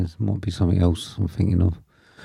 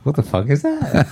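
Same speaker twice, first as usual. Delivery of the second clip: ordinary delivery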